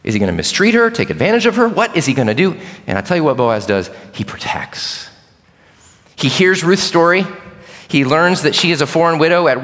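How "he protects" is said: dramatic hushed whisper. Acted. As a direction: breathe it like a secret revealed. Dramatic reveal of Boaz's action.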